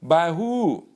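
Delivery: high, loud retort; imitated loud, high-pitched defiance; indignant Lawal. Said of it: "He goes".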